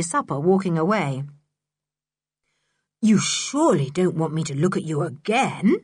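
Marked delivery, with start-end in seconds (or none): none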